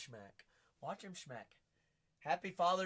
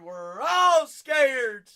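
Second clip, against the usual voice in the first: high, loud and slow; loud joking shout; 10 semitones higher, 17 dB louder, much slower than usual. Real, not acted. Host joking about the auction, loud slow shout. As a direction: drag it out, mock panic. Joking fear of losing job.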